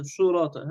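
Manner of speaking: "صراطا" with rounded "su" at the start; imitated mistake, u vowel in "صراطا"; lips rounded wrongly. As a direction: purse the lips into surat for "صراطا". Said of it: "كثير يضم أولًا شفتين".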